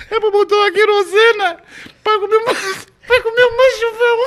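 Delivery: very high, loud wheeze of laughing speech; real laughing fit, squealed and loud; helpless laughter. Real, not acted. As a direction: force the words out while cracking up. Overcome by laughter at own joke.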